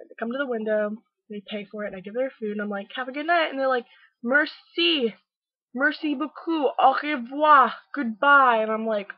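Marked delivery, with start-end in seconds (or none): 3.0-3.5 bright, louder customer-service "Have a good night"; cheery send-off. Retold or imitated. Drive-thru worker cheerfully seeing customers off, bright and raised. 4.2-8.6 loud, high, hammed-up French "Merci beaucoup. Au revoir."; mock French. Tourists thanking the drive-thru worker, hammed-up French accent, loud. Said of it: "French English".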